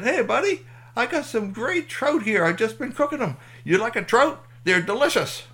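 high, loud, folksy friendliness; boisterous campfire host; hearty camper. Acted. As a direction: eagerly offer food to a new buddy. Clem heartily offers trout.

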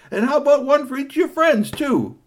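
high, loud offer; generous vendor. Acted. Vendor offering dogs to the friends, high loud. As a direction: push the treat cheerfully.

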